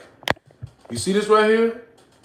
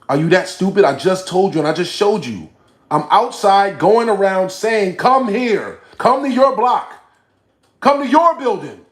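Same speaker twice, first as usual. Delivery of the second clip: loud, climbing higher into a yell; exasperated yelling; 5 semitones higher, 6 dB louder than usual. Real, not acted. Streamer telling a viewer he already went outside, exasperated yelling. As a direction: build from irritation to a full shout.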